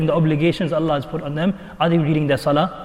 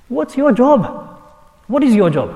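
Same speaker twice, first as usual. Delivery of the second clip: higher, louder rhetorical challenge; challenging; 6 semitones higher, 4 dB louder than usual. Retold or imitated. Questioning the father about his job, higher and louder. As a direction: press him on his duty.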